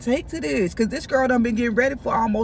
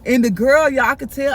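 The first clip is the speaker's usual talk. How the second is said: ordinary talk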